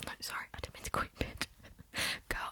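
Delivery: ordinary delivery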